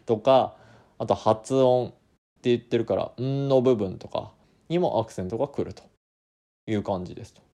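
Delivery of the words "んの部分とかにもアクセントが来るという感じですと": usual speaking voice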